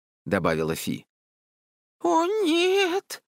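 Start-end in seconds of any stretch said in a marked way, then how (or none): 2.0-3.2 very high, frightened squeak; whimper. A narrator's reading. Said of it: "всхлипнул Чонси". (испуганный писклявый всхлип)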